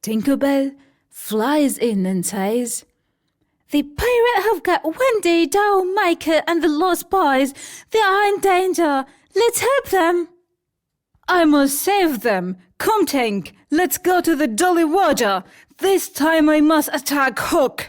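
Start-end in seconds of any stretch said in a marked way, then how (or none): 3.7-10.3 very high, fast, louder alarm; tiny fairy Tinker Bell. Acted. Narrator voices Tinker Bell. 11.3-17.8 higher, louder, fast, ending in a shout; heroic Peter. Acted. Peter rallies Tink.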